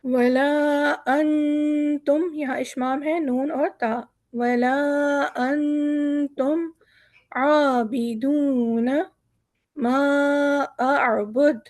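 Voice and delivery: very slow, drawn-out recitation; Quranic recitation. Shown as a demonstration. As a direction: chant each phrase slowly. Recites Surah Kafirun slowly. (धीमी तिलावत)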